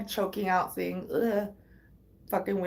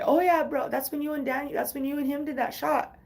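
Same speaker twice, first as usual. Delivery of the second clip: higher, casual bro voice; lightly voiced careless brother; imitating the brother; 6 semitones higher than usual. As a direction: toss it off like he doesn't know he's spilling. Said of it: "his brother at the time was like".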